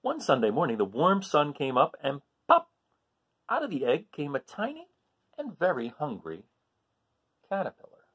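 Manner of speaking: lively storybook reading voice; animated picture-book narration; reading to children. Acted. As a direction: read it aloud to a class, expressive.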